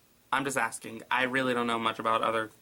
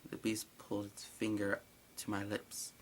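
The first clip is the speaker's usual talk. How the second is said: ordinary talk, nothing marked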